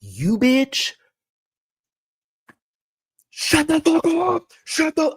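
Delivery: mock screaming. Castmate screaming at J K, high mock screaming. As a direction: shriek it like a catfight. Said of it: "I'm like".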